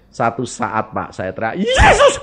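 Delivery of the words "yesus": much higher, loud cry of Jesus; shout. Retold or imitated. Woman cries out as her voice returns, shouting. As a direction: burst out with the name.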